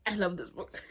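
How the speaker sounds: whispered. Real, not acted. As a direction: breathe it without voice. Unvoiced whispered line.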